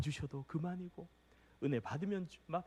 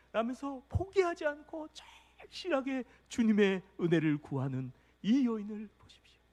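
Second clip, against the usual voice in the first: normal talking voice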